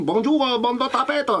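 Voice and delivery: high-pitched, exaggerated mock Chinese accent; hammed-up imitation of a dubbed Chinese-accented character; Chang's Chinese accent. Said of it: "il a parlé comme ça, avec cet accent, euh, chinois".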